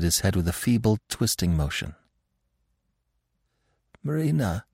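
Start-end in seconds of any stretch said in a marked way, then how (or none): 4.0-4.7 weak. A narrator's reading. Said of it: "he said with an effort".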